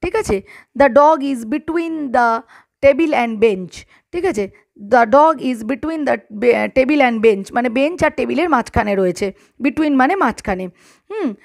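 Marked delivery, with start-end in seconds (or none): 0.8-3.7 deliberate word-by-word example; slow example for pupils; slow dictation. Shown as a demonstration. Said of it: "dictating example sentence". 4.9-7.5 deliberate word-by-word example; slow example for pupils; slow dictation. Shown as a demonstration. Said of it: "repeated dictation".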